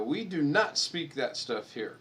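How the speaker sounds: slow, deliberate, hammed-up scolding; mock stern correction to his wife; slow mock lecture. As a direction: spell it out slowly like a stern patriot. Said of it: "I go".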